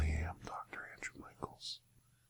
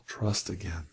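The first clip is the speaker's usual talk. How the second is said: whispering. Acted. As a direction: breathe the words out. Whispered single word.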